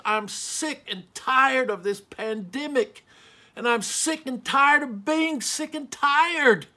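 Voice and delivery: loud, exasperated outburst, rising in pitch; fed-up anger. Real, not acted. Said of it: "I feel it".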